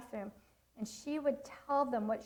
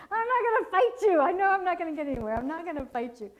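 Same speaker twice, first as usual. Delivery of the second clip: put-on resigned toddler voice; 7 semitones higher, 7 dB louder, faster than usual. Voicing the baby's surrender.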